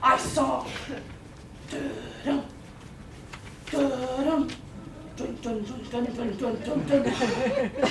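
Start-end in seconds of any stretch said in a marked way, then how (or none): 1.7-7.9 low, hushed "dun-dun" building in pulses; ominous shark theme. Acted. Narrator announcing the sharks' arrival, hushed ominous theme. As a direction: chant a soft, menacing film-score motif.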